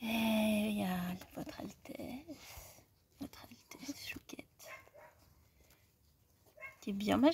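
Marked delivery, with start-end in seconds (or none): 0.0-4.4 slow unvoiced whisper. Real, not acted. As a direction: whisper it, slowly and softly. Whispering to a cat.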